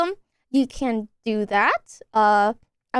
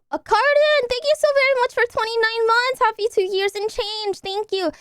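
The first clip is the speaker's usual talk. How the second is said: excited high thanks; 9 semitones higher than usual. Real, not acted.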